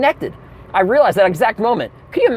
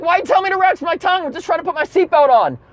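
exasperated; 10 semitones higher, faster than usual. Retold or imitated. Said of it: "I was like".

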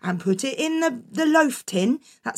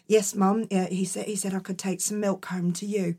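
put-on meek boy; 5 semitones lower, 6 dB quieter than usual. Jack answering Mum meekly.

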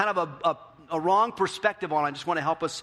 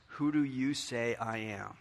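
softer, slow and grave; solemn question; 4 semitones lower, 5 dB quieter, slower than usual. Acted. Pastor poses Jesus' question softly to everyone. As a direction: ask it gently, let it land.

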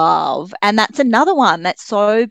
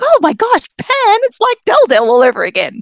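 excited realization; 14 semitones higher, 7 dB louder than usual. Retold or imitated. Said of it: "I was like".

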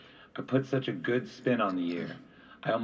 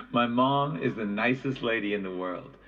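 ordinary delivery, nothing marked

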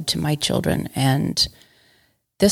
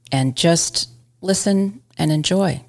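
ordinary delivery, nothing marked

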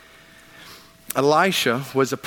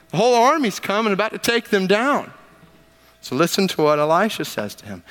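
ordinary talk

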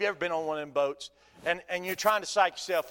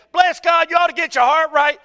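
loud, high preachy rebuke; hammed-up holier-than-thou scolding; mock pious scold; 10 semitones higher, 13 dB louder than usual. Preacher mocking critics who scold Peter, loud and high.

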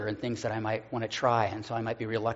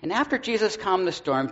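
ordinary talk

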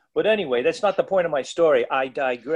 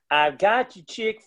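slow, higher Southern lady drawl; mock Southern accent, pitched up; 5 semitones higher than usual. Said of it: "with a Southern accent".

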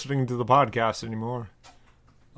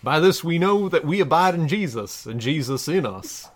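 measured, solemn Bible reading; scripture reading; 2 semitones higher, 4 dB louder than usual. Acted. Reading a parody Bible passage to listeners, solemn scripture voice. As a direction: read it like a pulpit lesson.

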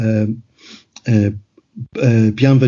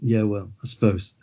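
grudging concession; 4 semitones lower, 8 dB quieter than usual. Retold or imitated. Neighbours grudgingly accept the excuse.

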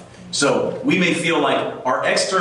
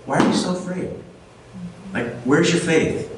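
higher, calm rebuke from Jesus; Jesus rebuking; 4 semitones higher than usual. Acted. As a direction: ask gently why they are so afraid, a touch of challenge. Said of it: "He's like".

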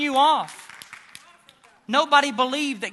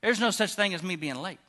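Jesus speaking softly; 6 semitones lower, 8 dB quieter than usual. Acted. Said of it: "Jesus is saying".

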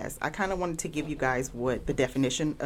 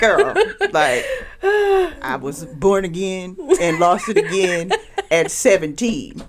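laughing; 5 semitones higher, 7 dB louder than usual. Real, not acted. Laughing through joke.

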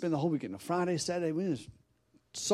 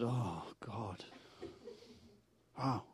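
stunned; 2 semitones lower, 12 dB quieter, much slower than usual. Retold or imitated. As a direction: say it softly, as if taken aback. Stunned reply to farmer's drastic sacrifice.

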